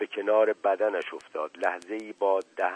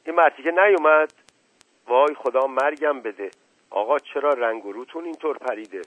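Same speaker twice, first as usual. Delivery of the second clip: higher, louder alarmed cry; alarmed outburst; 5 semitones higher, 5 dB louder than usual. A narrator's reading. Aziz bursts in alarmed at the uncle's pale face. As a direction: burst in fretting.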